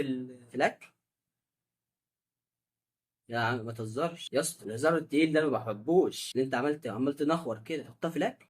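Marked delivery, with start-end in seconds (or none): 3.3-8.5 soft, low grumbling in disgust; disgusted mutter. Real, not acted. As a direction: grumble it under your breath. (تذمر هادي باشمئزاز)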